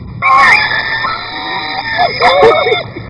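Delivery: high shrieking laugh; screaming laugh. Real, not acted. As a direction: shriek it out as the ice lands. Ice water dumped on her.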